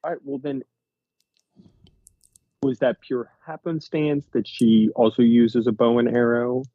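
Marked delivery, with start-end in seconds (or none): none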